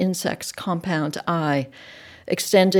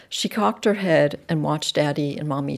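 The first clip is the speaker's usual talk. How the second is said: ordinary delivery, nothing marked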